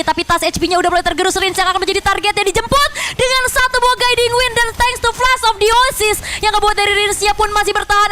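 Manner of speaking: fast, high, rising esports call; play-by-play commentary. Acted. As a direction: call the teamfight with rising hype.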